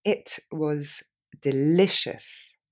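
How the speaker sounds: slow, drawn-out model phrase; slowly. Shown as a demonstration. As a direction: stretch delicious out very slowly. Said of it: "Plus lentement".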